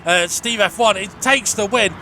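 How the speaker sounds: brisk, upbeat results call down the finishing order; live race commentary. Acted. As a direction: call the placings like a trackside commentator.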